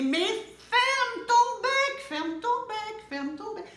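very high, very loud drawn-out yell fading to speech; all yelling the chick away; big shout. A narrator's reading. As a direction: scream it then trail off. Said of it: "tous recommencent à crier".

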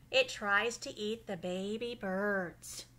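usual speaking voice

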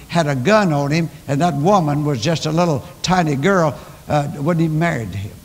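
ordinary talk

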